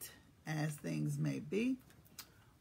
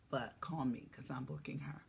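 whisper; 6 semitones lower, 10 dB quieter than usual. Real, not acted. Secretive aside to viewers.

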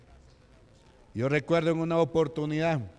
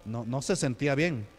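ordinary talk, nothing marked

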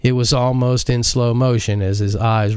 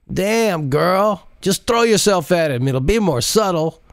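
higher teasing male sidekick voice; Jacinto teasing; 10 semitones higher than usual. Acted. Jacinto mocks Modise for flirting, in a higher teasing voice. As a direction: rib her about the pilot, higher voice.

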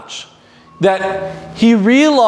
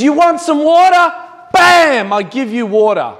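angry, frustrated outburst; 7 semitones higher than usual. Retold or imitated. Moses, sick of the complaining, yells at the people in frustrated anger. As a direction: act out a fed-up leader's outburst, dropping after the bam. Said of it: "in his anger, in his frustration".

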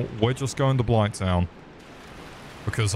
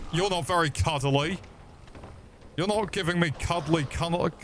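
higher, softer cooing; baby talk; 7 semitones higher, 4 dB quieter than usual. Acted. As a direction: coo at it like a pet. Cooing at a monster.

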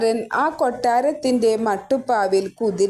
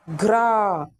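monster roar; 2 semitones lower, much slower than usual. Acted. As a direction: roar like a forest demon. Demon roars in the forest. (രാക്ഷസന്റെ ഗർജ്ജനം)

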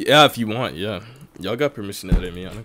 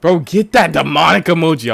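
raised, higher indignant voice; loud protest; 6 semitones higher, 10 dB louder than usual. Real, not acted. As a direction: protest loudly at the chat. Outraged at an emoji in chat.

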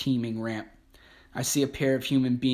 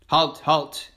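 shouted command, louder; yelling; 3 semitones higher, 6 dB louder than usual. Acted. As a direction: yell it after the truck. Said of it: "an S S man yells after them".

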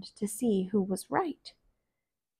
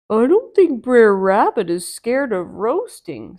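bear voice; 7 dB louder than usual. Acted. Bear doubts the rabbit's fear, different voice.

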